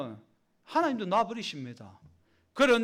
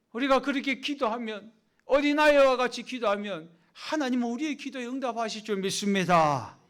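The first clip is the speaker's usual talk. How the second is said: ordinary talk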